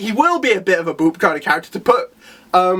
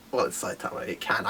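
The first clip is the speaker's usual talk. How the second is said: soft whisper to yourself; whispered aside; 12 semitones lower, 14 dB quieter than usual. Retold or imitated. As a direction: breathe it out like a private joke. Inner voice answering itself.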